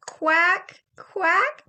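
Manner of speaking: high quacks with a rise; duck quack. Shown as a demonstration. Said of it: "make a quack sound".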